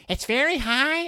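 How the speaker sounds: very high, thin cartoon squeak; squeaky high mock voice. Reviewer parodies the narrator's high Brother voice, squeaky. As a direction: pitch way up to parody the reader. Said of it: "it does not sound like you would imagine Brother".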